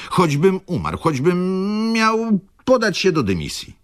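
high, hammed-up insistence; melodramatic vow. Clerk insists he must leave early, hammed-up and high. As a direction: swear it like a tragic hero.

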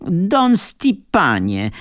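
high, slow, drawn-out shout of the name; shrieking summons, slow and high; sudden shout. A narrator's reading. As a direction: hammer the name out, high and stretched. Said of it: "krzyknęła nagle ta przeklęta kobieta".

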